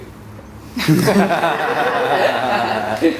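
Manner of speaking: bright rising laugh; a short amused laugh that rises; real laugh. Real, not acted. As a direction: laugh at the memory. Laughs about how small he was.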